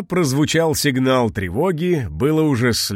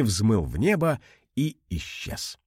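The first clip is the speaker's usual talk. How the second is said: ordinary delivery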